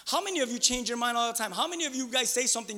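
ordinary talk

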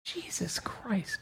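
whispered, slow and high; awed whisper. Real, not acted. Hearing about the nineteen mind darts, awed whisper. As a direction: breathe it out in awe. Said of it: "whispered reaction".

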